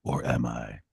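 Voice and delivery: low sinister tease; ominous villain question; mad scientist. Pretend. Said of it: "I'm kidding".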